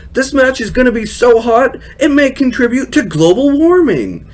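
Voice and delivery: mock the old commentator's cheesy line up high; Jerry Lawler impression. Mocking Jerry Lawler's cheesy ringside joke, high hammed-up voice. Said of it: "Jerry Lawler's horn dog comment of the week".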